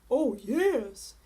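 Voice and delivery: low, loud, slow dragon boom; a big lazy dragon brushing off a princess. A narrator's reading. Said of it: "said the dragon".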